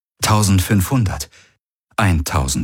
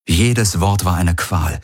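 plain reading voice

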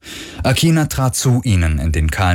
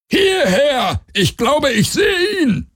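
very high creature voice; piercing high-pitched cry; alien hunter's call; slower than usual. Acted. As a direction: screech it to the other hunters.

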